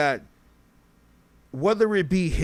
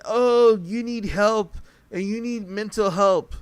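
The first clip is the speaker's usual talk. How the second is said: mocking; 6 semitones higher, 4 dB louder than usual. Mocking what rappers push.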